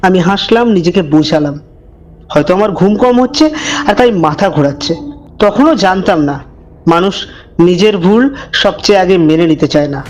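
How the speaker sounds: low, hushed storyteller voice; eerie narration, measured and dark; horror story narration. A narrator's reading. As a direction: draw the listener into a creepy tale. Horror story narration before intro.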